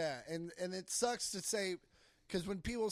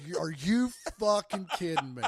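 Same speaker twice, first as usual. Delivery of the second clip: louder, laughing through the words; laughing disbelief; 9 dB louder than usual. Retold or imitated. Comic to co-host about undeserved success, laughing disbelief. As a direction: scoff in amused disbelief. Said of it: "some people out there like".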